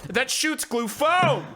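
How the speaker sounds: high, slow, with "foam" hit hard and drawn out; emphasis on foam. Retold or imitated. Vendor's inflection demonstrated, stress on "foam". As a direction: imitate the vendor leaning heavily on "foam". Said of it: "places the emphasis on foam".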